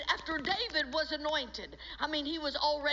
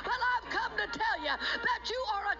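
higher, driving, emphatic sermon delivery; full-force preaching; 4 semitones higher than usual. Real, not acted. Preacher telling believers to stand up to the devil, forceful preaching. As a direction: drive it home to the congregation with authority.

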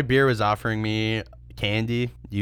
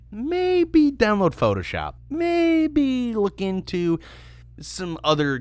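mocking advice; 12 semitones higher, slower than usual.

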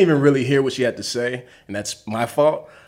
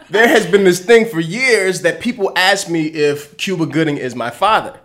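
ordinary talk

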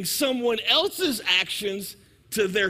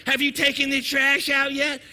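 higher, louder nagging question; nagging wife; 4 semitones higher, 5 dB louder than usual. Acted. Wife standing over overflowing trash, loudly insistent nagging. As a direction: press it like an exasperated wife. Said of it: "she's standing over the trash looking at it".

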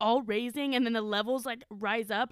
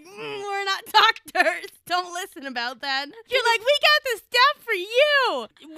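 shady dealer tease; 7 semitones higher, 8 dB louder than usual. Retold or imitated. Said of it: "That's what we're like".

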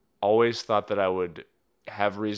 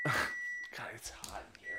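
whispering; 10 dB quieter, slower than usual. Real, not acted. Whispered complaint about heat.

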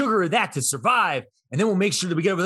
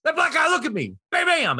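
frantic zombie husband; 10 semitones higher than usual. Retold or imitated. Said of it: "he'll be like".

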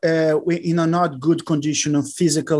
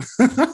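quick giggle up high; short high laugh; 8 semitones higher than usual. Real, not acted. Joking affection to host.

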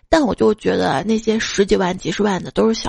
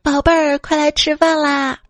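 put-on calling kids to dinner; 5 semitones higher than usual. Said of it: "叫她的外孙女儿来吃饭". (拉长声音喊孩子吃饭)

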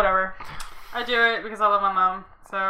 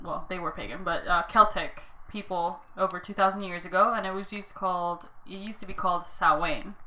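ordinary delivery

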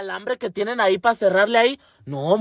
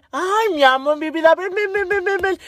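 put-on higher, sing-song baby talk to a dog; cooing at a dog; 11 semitones higher than usual. Friend on lookout coos to the dog, cooing. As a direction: coax the dog in sweet pet talk.

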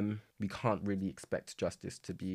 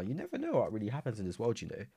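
normal talking voice

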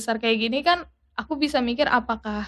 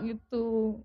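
ordinary talk

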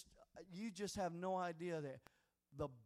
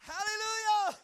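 loud, higher, shouted sermon climax; a shouted, much higher and louder revival finish; preaching at full force; 11 semitones higher, 13 dB louder than usual. Acted. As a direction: preach it hard to the congregation.

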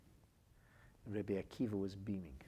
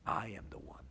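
whispered boast of a self-made man; smug whisper. Acted. Rabbi whispers the arrogant man's boast, smug. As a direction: breathe the boast like a secret.

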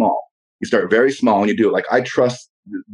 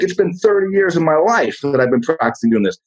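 ordinary talk, nothing marked

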